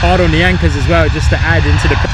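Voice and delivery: loud, eager call of the hard landing; loud live plane-spotting call; excited commentary. Real, not acted.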